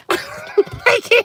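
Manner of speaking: high squeal through real laughter; too tickled to get it out; helpless laughter. Real, not acted. Helpless laughter at product wording.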